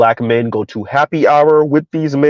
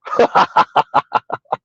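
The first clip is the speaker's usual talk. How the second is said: breathy laugh. Real, not acted. Laughing at delusion.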